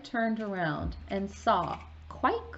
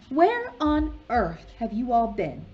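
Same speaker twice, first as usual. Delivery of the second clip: Fat Lady; 4 semitones higher, 7 dB louder than usual. Acted.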